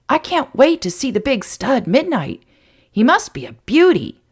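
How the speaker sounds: put-on high, excited kid voice; lightly voiced eager boy; boy talking with his mouth full. A narrator's reading. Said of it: "said Benji through a mouthful".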